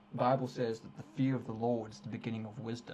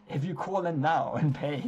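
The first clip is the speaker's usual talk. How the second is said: televangelist pitch; 4 semitones higher, 7 dB louder than usual. Acted. Spoofs a preacher's phone-in pitch.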